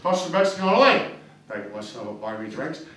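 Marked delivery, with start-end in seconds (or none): 1.5-2.9 soft Jesus. Acted. Jesus voiced softly again.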